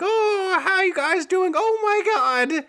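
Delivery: high fussy shop lady shrieking at a rat; Missus Sweet. Retold or imitated. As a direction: play a flustered lady in a high voice. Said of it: "Missus Sweet's like".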